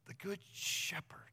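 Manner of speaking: hushed echo to let it sink in; whispered echo. Shown as a demonstration. Pastor echoes the verse to the congregation in a whisper. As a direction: whisper the good shepherd, rising on good. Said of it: "I am the good shepherd".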